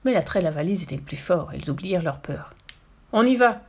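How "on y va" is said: short, louder, higher rallying cry; shout. A narrator's reading. Burglar rallying his partner to jump, shouting. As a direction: call your partner into the attack. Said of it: "cria Carlson l'éclair".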